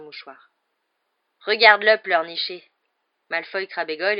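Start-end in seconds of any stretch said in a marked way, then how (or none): 1.5-2.6 loud, mocking jeer; loud schoolboy sneer. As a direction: throw it out as a loud taunt. Malfoy mocks weeping Hagrid.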